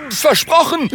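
ganz hoch und kleinlaut; ängstliches Versprechen. Acted. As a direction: versprich es verängstigt, mit hoher Stimme. Verängstigter Ritter gibt nach. (ängstlich, mit sehr hoher Stimme)

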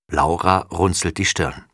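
plain reading voice

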